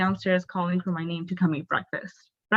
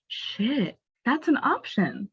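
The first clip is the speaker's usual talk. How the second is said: higher, dawning amazement; mind-blown realization; 8 semitones higher than usual. Retold or imitated. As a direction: act out a sudden realization, pitch climbing. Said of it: "it just blew my mind".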